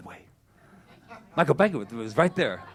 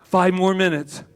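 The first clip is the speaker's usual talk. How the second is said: loud, raised plea for more sleep; protesting snoozer; 4 semitones higher, 8 dB louder than usual. Acted. Speaker acts a sleeper hitting snooze, protesting snoozer. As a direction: call it out at the alarm.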